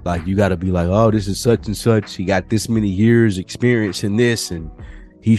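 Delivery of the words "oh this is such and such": normal talking voice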